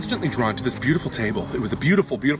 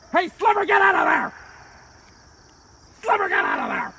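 very high, loud yell; high shout across the yard; shouting at the dog; 6 dB louder than usual. Acted. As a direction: scold the dog from afar. Yelling at the dog.

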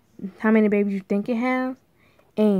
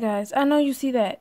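usual speaking voice

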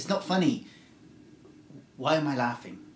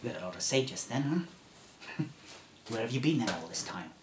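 lonely survivor. Acted. Last human telling the stranger no one else lived, building. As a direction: start hushed, then let it pour out. Final take of the speech.

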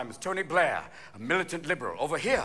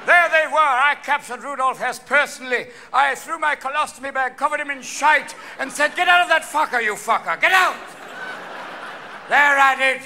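put-on old English veteran; 6 semitones higher, 5 dB louder than usual. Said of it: "Old men with pitchforks and colostomy bags".

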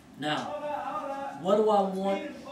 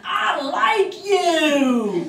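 slow, much louder and higher booming shout; big shout; 13 semitones higher, 16 dB louder, much slower than usual. Retold or imitated. Inmate jumps up and points at Chuck, a booming shout. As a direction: bellow it out, stretching every word.

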